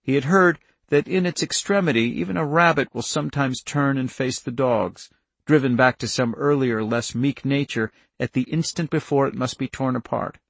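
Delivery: plain reading voice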